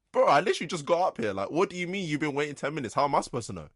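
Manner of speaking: fast, high exasperated protest; rapid-fire annoyed comeback; angry retort. Retold or imitated. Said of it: "I'm actually angry".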